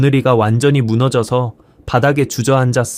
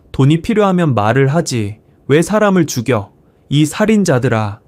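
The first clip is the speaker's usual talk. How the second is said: plain reading voice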